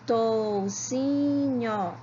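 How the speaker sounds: "Toucinho" stretched into tou, ci, nho; slow syllable by syllable. Shown as a demonstration. Teacher checking syllable counts with pupils, "Toucinho" slowly split. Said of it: "Vamos falar todas devagarinho".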